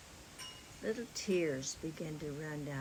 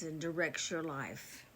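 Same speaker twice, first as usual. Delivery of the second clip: normal talking voice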